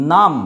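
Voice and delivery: নাম ending on m, no b; silent B. Shown as a demonstration. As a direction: close নাম on the m sound. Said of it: "এই বি এর উচ্চারণ হয় না".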